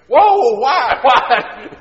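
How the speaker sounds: put-on very high, loud boyish yelp; shocked protest. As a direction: protest in comic alarm. Boy protests spanking.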